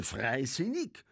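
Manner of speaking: slow, high, rising question; questioning, stretched repeat; incredulous echo. A narrator's reading. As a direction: draw out FREISINNIG as a scornful question. Indignant echo of disputed word.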